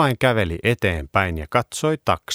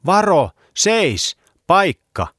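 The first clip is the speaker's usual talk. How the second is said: high urgent shout, slow; loud warning cry; 12 semitones higher, 6 dB louder, slower than usual. A narrator's reading. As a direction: yell a sharp warning to the dog.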